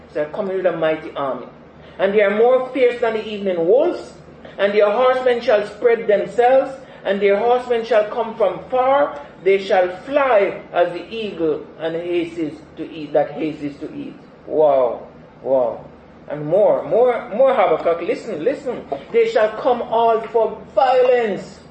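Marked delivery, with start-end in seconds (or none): 2.0-14.1 fiery scripture reading. Acted. Reading prophecy with fire. 19.1-21.6 drawn-out, loud, high pronouncement; preacher thundering a prophecy; slow proclamation. Acted. Declaiming scripture slowly.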